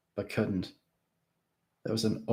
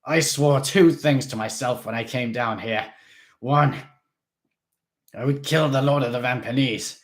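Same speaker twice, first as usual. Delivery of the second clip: loud, raised and determined; fierce vow; 4 semitones higher, 7 dB louder than usual. A narrator's reading. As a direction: swear it out loud. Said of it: "Mister Krebsley howled".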